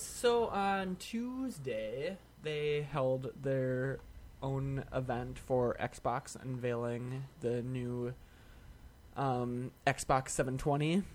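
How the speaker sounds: very slow, drawn-out announcement; slow announcer. Acted. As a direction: stretch each word like a big reveal.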